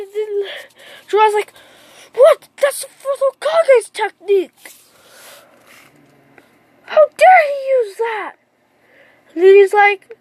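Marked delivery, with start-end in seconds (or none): 2.1-8.4 high-pitched acted indignation; shocked outrage. Jiraiya seeing the forbidden technique used, shocked outrage. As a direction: burst out high, scandalised on the character's behalf.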